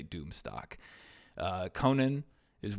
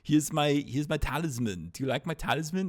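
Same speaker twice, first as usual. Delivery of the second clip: louder, higher hammed-up oversell; mock eager Conan; 6 semitones higher, 5 dB louder than usual. Conan showing the cult his talisman, in an eager mock voice. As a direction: proudly show off the talisman, overselling it. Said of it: "and he's like".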